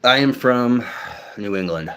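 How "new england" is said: whisper. Real, not acted. Muttered hometown answer.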